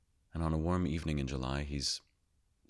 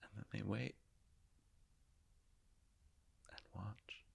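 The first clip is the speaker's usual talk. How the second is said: soft, slightly higher, halting narration; hushed storytelling; 5 semitones higher, 7 dB quieter than usual. Acted. Telling listeners of the scientists watching a second Big Bang, hushed. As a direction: let the words out softly, with long pauses.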